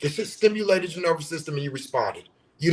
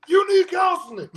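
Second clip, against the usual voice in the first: mock shout; 6 dB louder than usual. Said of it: "Me reacting to something is just".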